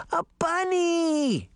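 high, slow squeal of delight; little girl thrilled to see a bunny; stretched-out gleeful exclamation; delighted cry. Acted. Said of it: "Daphne cried".

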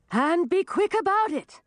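high-pitched urgent order; shouting. A narrator's reading. Dragoness holding the old woman to helpers, shouting. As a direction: shout to hurry them along. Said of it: "shouted Song of the Glacier".

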